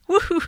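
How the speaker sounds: high "woo-hoo" cheer; high, playful woo; celebratory whoop. Real, not acted. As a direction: let out a high little whoop. Cheering her overlong recap.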